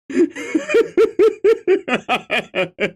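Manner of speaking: long high cackle; helpless high-pitched giggling; laughing. Real, not acted. Reader cracking up over the blood note, high cackle.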